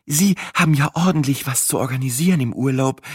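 lightly voiced kind farm wife; a hint of a motherly farm wife; gently voiced farm mother making small talk; kindly farm mother.

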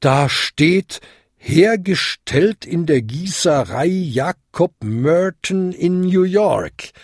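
Ding reading aloud. A narrator's reading. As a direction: announce the shocking inscription. Ding reads the inscription.

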